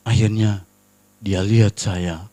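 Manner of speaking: ordinary talk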